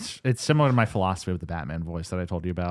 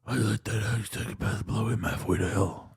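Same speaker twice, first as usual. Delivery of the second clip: slow, half-hearted Batman line; a hint of Batman, no build-up; flat Batman without layers; 6 dB quieter than usual. Shown as a demonstration. Host shows the lazy Batman version. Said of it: "you're just like".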